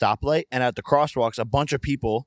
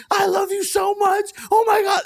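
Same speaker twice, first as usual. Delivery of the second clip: mock fan screaming; 4 dB louder than usual. Shouting at strangers from a car window, high hammed-up gush. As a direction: squeal it out like a starstruck fan.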